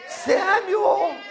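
shouted preaching, high and loud; preaching at full force. Acted. Preacher rousing the church, shouting. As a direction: build the congregation up at full voice.

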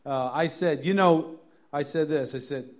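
ordinary talk, nothing marked

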